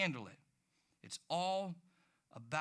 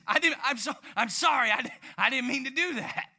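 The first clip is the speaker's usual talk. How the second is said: flustered apology; 6 semitones higher, 7 dB louder than usual. Retold or imitated. Apologizing after scare reaction.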